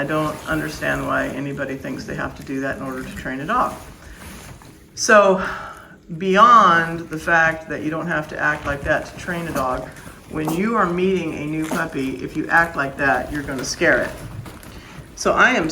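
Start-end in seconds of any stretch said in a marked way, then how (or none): none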